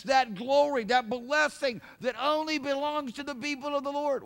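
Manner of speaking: loud, high, fervent sermon delivery; full-force preaching. Acted. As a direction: preach it hard to the congregation.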